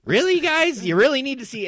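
high, loud mock disbelief; incredulous. Reacting to porn on the bar's TVs, incredulous. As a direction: voice leaping up in exasperated protest.

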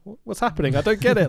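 high, bewildered mock actress; mocking a clueless actress, bewildered; mock confusion. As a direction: sound lost and clueless.

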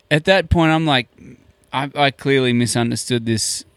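normal talking voice

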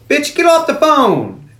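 high, slow drawn-out mock yell at the phone; mock Zeus yell. Zeus snapping at a woman on the phone, high drawn-out yell. Zeus voiced yelling at caller.

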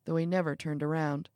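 ordinary delivery

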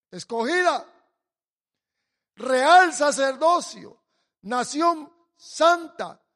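loud, slow proclaimed scripture; preacher proclaiming the verse loudly and slowly; preaching at full force. Acted. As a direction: thunder each phrase to the church.

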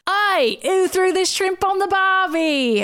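high, slow Australian drawl; Australian accent. Acted. Pyro announcing himself, Australian accent. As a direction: ham up the Aussie accent. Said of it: "A familiar Australian accent".

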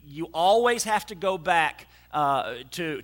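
ordinary talk

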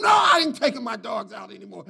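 firm retold refusal; emphatic refusal. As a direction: barber swears off walking the dogs. Barber's answer, retold.

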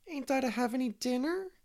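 put-on high-pitched childish wail; plaintive, high acted wail. A narrator's reading. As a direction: wail like a hard-done-by little boy. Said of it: "wailed Davy".

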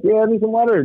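high, strained excuse; choked-up cover. Retold or imitated. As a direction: fake it while holding back tears. Covering up tears.

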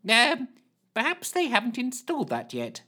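high hesitant flustered voice; flustered Lushthorpe. Acted. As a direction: dither high and unsure.

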